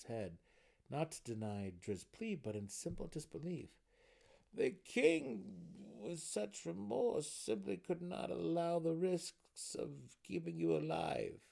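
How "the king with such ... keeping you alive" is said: high-pitched, regretful gnome; somber. A narrator's reading. Counselor explaining the king's decision to prisoner, somber. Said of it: "he said somberly".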